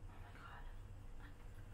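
slow, breathy whispered oh my God; exasperated whisper. Real, not acted. Fed up with the sippy top, whispering. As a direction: drop to a drawn-out whisper in despair.

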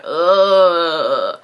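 long, drawn-out "ugh"; reluctant groan. Retold or imitated. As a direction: groan as you grudgingly give in. Gives in reluctantly.

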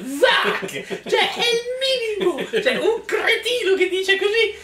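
high-pitched incredulous laughing. Real, not acted. Mocking a silly story, laughing high. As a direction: laugh through the words in disbelief.

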